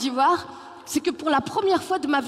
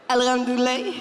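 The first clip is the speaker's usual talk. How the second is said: put-on Malian accent. Malian reply acted.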